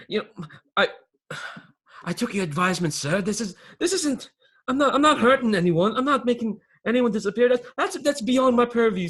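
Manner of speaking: high, loud, stammering self-defence; panicked underling. Acted. Accused underling insists it's beyond his purview, panicked. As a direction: protest your innocence, rising to a high, loud plea. Plays Phineas defending himself to boss.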